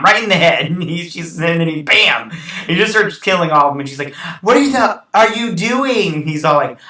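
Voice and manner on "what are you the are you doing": very high and louder, the last words dragged slowly; shocked disbelief. Retold or imitated. Retelling the scene, she is stunned by his rampage: shocked disbelief. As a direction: imitate her horrified disbelief as he starts killing. Partner shocked by sudden killing spree.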